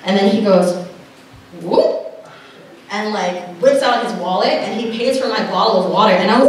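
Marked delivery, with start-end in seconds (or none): 1.6-2.3 short high-pitched "whoop"; high little sound-effect whoop; imitated whoop. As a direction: let out a quick playful whoop. Mimics his quick wallet move.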